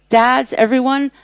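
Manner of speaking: ordinary delivery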